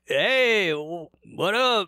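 high, drawn-out bro greeting; casual dude greeting. Retold or imitated. Golfer rolling up to Saeed, high lazy bro greeting. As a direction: greet him like a laid-back golf buddy.

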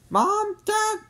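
put-on high-pitched proud kid; proud announcement. A narrator's reading. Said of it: "he announced proudly".

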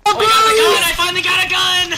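very high, loud gleeful yell; ecstatic shout. Real, not acted. Gamer screaming with joy at finally getting a gun. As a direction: scream it out in pure delight. Repeats triumph, louder yell.

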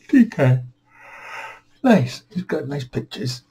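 a bright little cheer; delighted. Real, not acted. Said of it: "admiring the book brand".

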